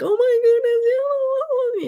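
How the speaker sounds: high, loud, drawn-out squeal of delight; excited child. Retold or imitated. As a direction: imitate a kid lighting up over a present. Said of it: "light up and be like".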